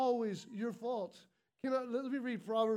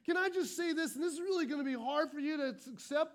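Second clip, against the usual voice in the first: normal talking voice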